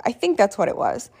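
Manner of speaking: normal talking voice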